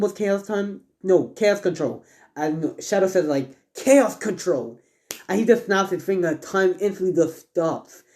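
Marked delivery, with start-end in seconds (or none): 3.7-4.8 slow, drawn-out attack call; power-move call. Acted. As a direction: announce the special move with drama. Character calls out his power move.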